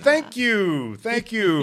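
high-pitched, drawn-out gratitude; high, showy thank-you; effusive thanks. Retold or imitated. Acting his reply to colonel's compliment.

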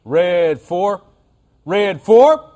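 high, loud quarterback call with a twang; twangy snap count. Shown as a demonstration. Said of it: "I like my twang better".

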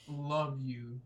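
put-on Kentucky accent like Ken Todd; a light Kentucky drawl on love you. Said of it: "In my Ken Todd voice".

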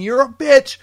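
high, loud mocking scream. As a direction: scream it high like a furious wife. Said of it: "Whole time screaming".

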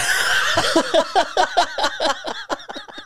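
long high breathless laugh; helpless giggle fit; high wheezing laugh. Real, not acted. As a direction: break up into a wheezy cackle. Laughing at dick joke.

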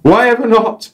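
high, slow, broad North English showman voice; North English guide. Acted. Guide on his radio mic, North English accent. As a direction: announce the bird in a chirpy North English accent. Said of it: "random North English accent".

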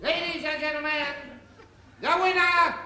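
loud, high announcer's proclamation; ring announcer. Acted. Announcer declaring the winner to the crowd, booming announcer voice. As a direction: boom it out to the hall.

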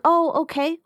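high-pitched imitated sales voice; lightly mocking salesperson acknowledgment. Imagined salesperson brushing past objection.

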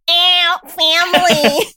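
slow high showy [name] squeal; laughing [name]-style shout; [name] impression. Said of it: "She's gonna be like".

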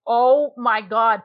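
retold amazement. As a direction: mimic being floored, stretching every word. Retold reaction to the twist.

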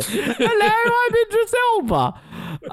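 very high, drawn-out joke impression; hammed-up squeaky impression, slowing on the name; Idris Elba. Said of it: "That's our impression of him too".

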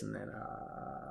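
very soft, slow creaky trail-off; vocal fry. Retold or imitated. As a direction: fade into lazy vocal fry. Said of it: "You got a lot of that vocal fry going on".

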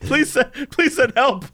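usual speaking voice

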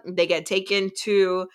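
ordinary delivery

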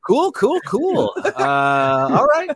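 high-pitched awkward cool cool; squeaky nervous brush-off. Pretend. Mock awkward reply voice.

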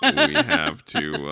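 words pushed out through laughter, slowly; laughing while talking. Real, not acted.

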